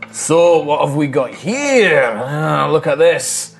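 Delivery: wild elf captor. Acted. Wild elf pulling his catch from the log, slow gloating. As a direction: savour each word as a captor. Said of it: "in Elvish".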